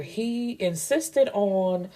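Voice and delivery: slow, drawn-out trailing off. Acted. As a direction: let the words drift away unfinished. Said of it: "his words trail off".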